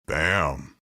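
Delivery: low, punchy exclamation; playful Bam. Acted. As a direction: land it like a punchline. Punchline after the intro.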